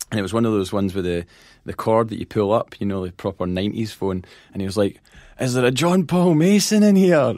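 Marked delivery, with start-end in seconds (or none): 5.4-7.3 high, loud call across a crowded pub. Retold or imitated. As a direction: page someone by name over the room. Barman calling out over the pub.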